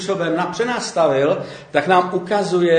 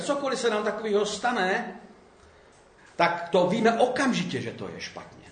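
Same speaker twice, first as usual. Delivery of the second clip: normal talking voice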